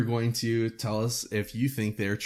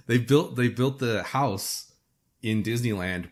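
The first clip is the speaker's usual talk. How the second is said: ordinary talk, nothing marked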